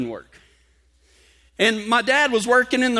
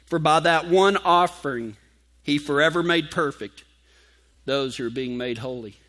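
scripture reading; 5 semitones lower than usual. Acted.

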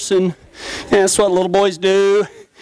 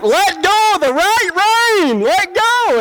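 urgent shout; 12 semitones higher, slower than usual. Retold or imitated. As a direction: yell it to save the rider. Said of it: "I'm like".

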